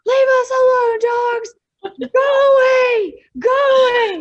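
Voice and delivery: high, very loud yell to scare dogs off; full-force, high-pitched warning shout; yelling to intimidate. Acted. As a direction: bellow at the dogs, stretching out GO AWAY. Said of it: "yell as loud as I can".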